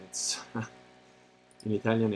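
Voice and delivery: real laugh breaking into "It's in Italian". Real, not acted. To the chat, amused that the text is in Italian, laughing. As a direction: laugh through the words "in Italian" as you repeat them. Repeats phrase, second time laughing.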